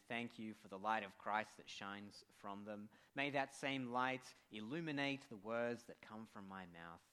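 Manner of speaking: steady, reverent prayer voice. Acted. Pastor opens the service in prayer, reverent. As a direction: pray it humbly before the sermon.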